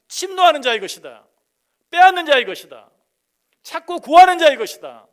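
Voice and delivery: loud, high, building proclamation; preaching at full force. Acted. Pastor driving the point home to the church, loud and rising.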